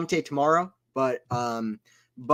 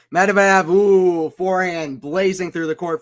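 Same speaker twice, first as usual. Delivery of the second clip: loud, higher gasp of a call; loud live exclamation; excited call; 3 semitones higher, 5 dB louder, slower than usual. Real, not acted. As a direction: react to the blazing shot. Blazing forehand on live call.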